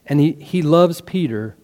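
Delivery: ordinary talk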